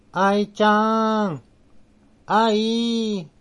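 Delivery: high, very slow, muffled eerie call in Nagoya dialect; muffled ghostly sister voice. A narrator's reading. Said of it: "その声はくぐもっており". (家の中から妹を呼ぶ、こもった不気味な姉の声)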